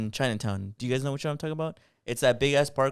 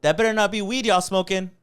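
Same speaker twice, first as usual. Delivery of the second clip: higher-pitched imitation of a scolding shout; retold yell; 8 semitones higher, 4 dB louder than usual. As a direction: call out accusingly, imitating the lady. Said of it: "yells out loud".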